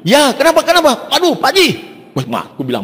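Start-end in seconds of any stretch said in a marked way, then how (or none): 0.0-1.1 alarmed reply. Retold or imitated. His own alarmed reply. 1.2-1.7 frantic caller. Retold or imitated. Caller voiced again.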